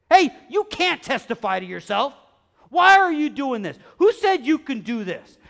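loud, high acted outrage; hammed-up angry objection; indignant challenge. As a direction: challenge him like an offended official.

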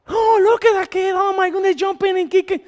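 high mock-fussy whine; fussy complainer. Preacher mocks a churchgoer fussing over a lively kid. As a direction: squeal it in fussy disapproval. Mocking complainer about kids.

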